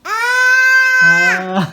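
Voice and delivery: high, loud drawn-out "aah" scream; long high mock shriek; imitating mom's yelling. Shown as a demonstration. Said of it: "అమ్మ ఎలా అరుస్తుంది".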